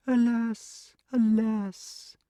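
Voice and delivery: high, sweet, simple snake's lament. A narrator's reading. Hidden snake lamenting to itself, high sweet wail. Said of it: "lamenting to itself in a very sweet, cold, simple voice, and crying".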